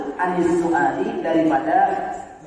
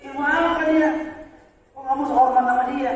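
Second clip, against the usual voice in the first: higher sneering question; scornful mocking; 8 semitones higher than usual. Scholars scoffing at Syafi'i's teacher, higher sneering question. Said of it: "diolok-olok sama ulama-ulama besar".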